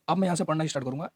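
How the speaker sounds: normal talking voice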